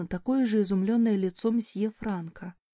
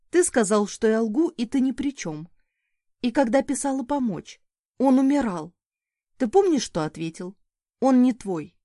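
angry; 3 semitones higher, 5 dB louder than usual. Acted. Mother confronting ex over son.

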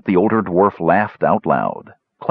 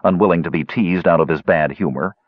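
plain reading voice

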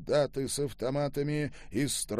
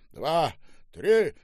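loud count, second number higher; barked count; 6 semitones higher, 7 dB louder than usual. A narrator's reading. Soldier times the jump, barked count. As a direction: call the count as a command. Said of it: "скомандовал один из солдат".

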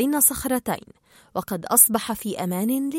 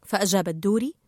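usual speaking voice

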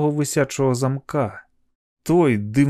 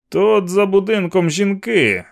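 raised, drawn-out call; shouting; 7 semitones higher, 4 dB louder than usual. A narrator's reading. Man warning police about women behind house, shout. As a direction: shout slowly so it carries. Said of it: "крикнув він".

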